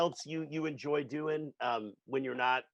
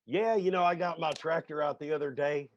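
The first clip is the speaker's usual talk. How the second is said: imitating a farmer; 4 semitones higher, 4 dB louder than usual. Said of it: "Somebody starts talking like".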